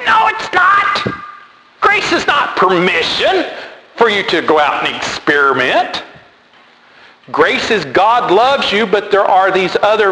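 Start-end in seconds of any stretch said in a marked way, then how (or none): 0.1-6.0 very high, incredulous squeal, easing down by the end; exasperated retort. Retold or imitated. Preacher answering a friend's excuse about grace, exasperated retort. Indignant reply to friend's letter.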